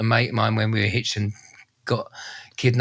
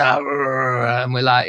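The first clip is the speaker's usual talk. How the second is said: ordinary talk, nothing marked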